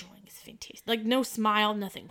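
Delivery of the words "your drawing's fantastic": soft breathy whisper, stone-faced; deadpan whisper. Retold or imitated. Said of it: "stone face".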